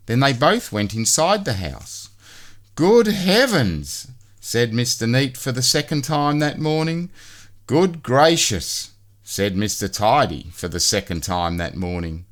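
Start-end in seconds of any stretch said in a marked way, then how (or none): 2.8-4.1 high, slow and aghast; prim horror at the mess; shocked good heavens. A narrator's reading. Mister Neat sees the messy house, slow and aghast. 7.7-8.9 high, slow and prim; fussy dismay; shocked good gracious. A narrator's reading. Mister Tidy sees the messy house, slow and prim.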